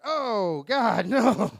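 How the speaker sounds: loud, high cry of dismay; horrified outburst. Acted. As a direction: cut her off with a drawn-out horrified yell. Cuts her off in horror.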